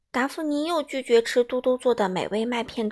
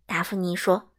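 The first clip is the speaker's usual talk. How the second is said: ordinary delivery, nothing marked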